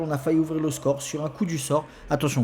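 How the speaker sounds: normal talking voice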